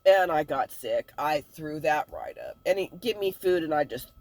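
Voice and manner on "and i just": ordinary delivery, nothing marked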